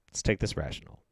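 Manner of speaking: quiet, low self-soothing; calming himself, softly. Real, not acted. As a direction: talk yourself down gently. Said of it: "Let's calm down".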